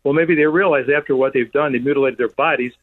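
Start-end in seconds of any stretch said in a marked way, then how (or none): none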